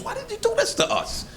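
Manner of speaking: a wronged king demanding answers; mock indignant king. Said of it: "He said".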